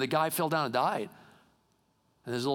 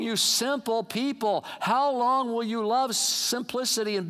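higher-pitched, ringing proclamation; forceful scripture preaching; 6 semitones higher than usual. Real, not acted. Wisdom crying out to simple people in the street, preached forcefully. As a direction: cry the verses out to the crowd.

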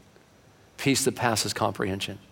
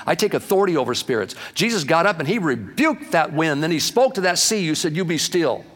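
fiery preaching; 6 semitones higher than usual. Acted. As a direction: preach it hard and fast to the congregation. Preaching at full force.

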